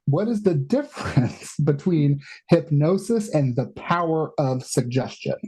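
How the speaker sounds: slowed down and careful after a stumble; slow careful retry. Real, not acted. Host retries a garbled question, slow and careful. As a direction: say it slowly after fumbling the words. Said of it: "Words hard".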